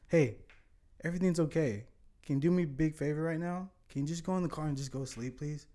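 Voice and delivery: calm a frightened friend into sleeping; hushed reassuring plea to go sleep in the car; soothing. Retold or imitated. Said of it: "She needs to hear something soothing".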